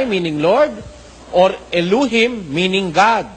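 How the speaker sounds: slow, deliberate quote reading; careful recitation of a printed note; slow reading aloud. Acted. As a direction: spell out each word of the passage.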